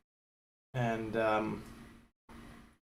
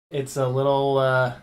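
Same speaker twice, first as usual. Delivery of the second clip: ordinary talk, nothing marked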